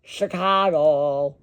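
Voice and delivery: slow, stretched Chicago; oddly stretched team name; strange drawn-out way. Real, not acted. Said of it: "Why did I say it like that?".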